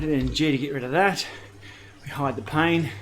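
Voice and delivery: ordinary talk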